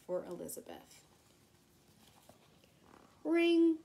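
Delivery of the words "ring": high, loud ringing call; loud bell imitation on a lifted pitch; imitated school bell. A narrator's reading. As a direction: sound out the bell, raised and ringing.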